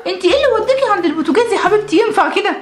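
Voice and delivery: quick, high-pitched telling-off; alarmed scolding. Acted. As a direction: scold the child out of worry. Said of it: "مالكوا بتزعقوا ليه كده؟".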